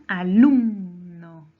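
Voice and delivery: slow "alumno" with a loud, long "lum"; penultimate stress. Shown as a demonstration. Teacher showing the penultimate syllable's force, loud drawn-out stress. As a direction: swell on "lum" in "alumno", then drop to "no". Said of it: "su mayor fuerza de voz en la penúltima".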